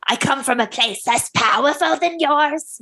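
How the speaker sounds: high, put-on otherworldly stranger; creepy visitor. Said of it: "So I'll be Indrid".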